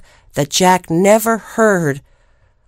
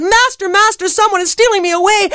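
much higher, fast shrill cry for help; harp crying out; 12 semitones higher, faster than usual. Acted. Stolen harp calls to the giant, much higher and fast. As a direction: panic for your master. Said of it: "the harp yelled out".

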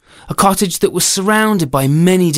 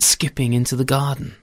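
plain reading voice

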